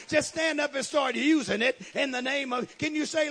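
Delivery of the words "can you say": usual speaking voice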